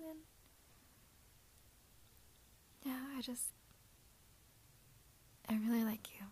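soft confessional whisper; ASMR whisper. Acted. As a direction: whisper it tenderly, close in.

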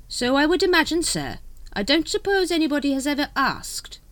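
high, polite courtier voice; deferential secretary. Acted.

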